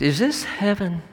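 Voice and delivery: high-pitched, awed question; lifted voice of someone amazed; retold wondering question. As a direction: ask it in wonder, voice lifting high. Father asks if field is heaven.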